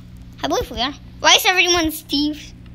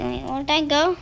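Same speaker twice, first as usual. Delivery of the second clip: normal talking voice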